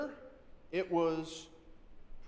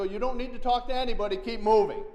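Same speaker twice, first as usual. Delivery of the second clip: fast, much louder, shouting him away; louder warning; 6 semitones higher, 9 dB louder than usual. Retold or imitated. Telling the approaching man to move on, loud fast shout. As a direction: drive him off with a raised voice. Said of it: "And the louder I got".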